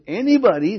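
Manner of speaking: "anybody" lifted high and loud; emphatic ANYBODY. Real, not acted. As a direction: drive "anybody" home, rising. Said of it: "I'm just emphasizing this".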